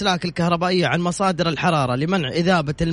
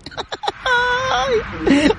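high squealing laugh. Real, not acted. Caller lost, host laughs.

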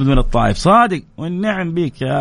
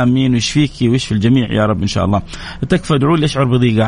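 ordinary delivery

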